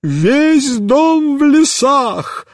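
slow, high wail of despair; despairing high lament. A narrator's reading. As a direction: lament it slowly. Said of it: "с отчаянием сказала".

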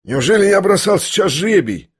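high-pitched, amazed questioning; jolted into astonished questions; startled disbelief. A narrator's reading. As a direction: ask in sudden bewilderment. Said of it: "вздрогнул он вдруг".

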